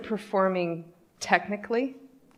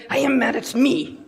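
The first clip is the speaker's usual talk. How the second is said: high, mock-angry Russian accent; heavy-hearted Russian-accented outburst, acted; 6 semitones higher, 4 dB louder than usual. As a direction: imitate an upset Russian engineer. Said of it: "I get the angry Russian".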